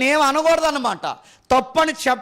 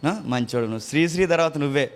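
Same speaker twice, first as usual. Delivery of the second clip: lower, sarcastic sweet-talk; mock coaxing; 10 semitones lower, 4 dB quieter than usual. Preacher mocking how to flatter an elder who scolds him, sarcastic coaxing. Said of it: "మరి ఎలా తిట్టాలి?".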